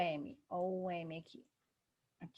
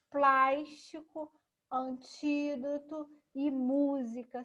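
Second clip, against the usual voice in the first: "plástico, antídoto e música" with PLÁS, TÍ and MÚ drawn out and raised; stressed syllables stretched; 6 semitones higher, 5 dB louder, much slower than usual. Shown as a demonstration. Teacher showing pupils the tonic syllables, PLÁS, TÍ, MÚ stretched. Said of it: "a sílaba tônica é o mu".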